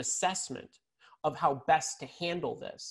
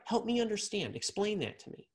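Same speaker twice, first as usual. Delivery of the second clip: softer and higher, an inviting plea; kind, gentle asking; 6 semitones higher, 4 dB quieter than usual. Shown as a demonstration. Coach showing empathetic listening, gentle and soft. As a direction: ask as if you truly want to know. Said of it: "in the kindest, most em-, empathetic way".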